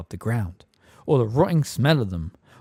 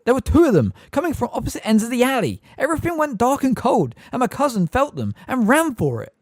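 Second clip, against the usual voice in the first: urgent; 13 semitones higher, 6 dB louder than usual. A narrator's reading. Boy pleading before the court.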